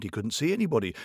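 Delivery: usual speaking voice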